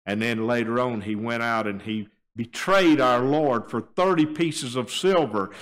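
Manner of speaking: usual speaking voice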